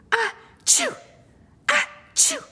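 a big high sneeze; sneezing Sue. A narrator's reading. Sue finally sneezing herself. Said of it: "sneezed Sue".

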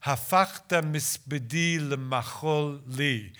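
"hafachta misbedi lemachol li" in Hebrew, the M sounds clear; Hebrew recited. Shown as a demonstration. Translator showing the class the Hebrew alliteration. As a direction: bring out the M alliteration. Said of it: "the Hebrew has an alliteration".

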